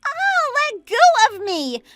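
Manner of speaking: high, loud acted scream. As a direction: cry out to break free from a grip. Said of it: "Stop screaming".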